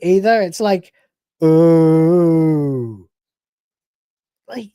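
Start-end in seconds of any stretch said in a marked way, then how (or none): 1.4-3.1 long, drawn-out dishonest ooh; mock ooh held too long; fake-sounding ooh. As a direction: imitate an insincere, put-on ooh. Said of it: "doesn't even sound like an honest ooh".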